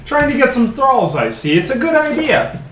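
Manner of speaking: sing-song high teasing; exaggerated cartoon intonation. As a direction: tease him, cartoonishly. GM mocks character's scheme.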